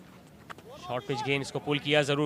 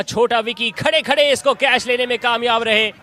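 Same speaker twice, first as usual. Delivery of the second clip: higher and louder on the catch; excited cricket commentary; 5 semitones higher, 7 dB louder than usual. Real, not acted. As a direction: lift the voice for the wicket. Catch taken live.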